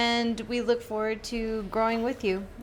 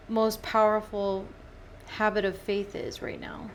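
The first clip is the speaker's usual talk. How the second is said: ordinary talk, nothing marked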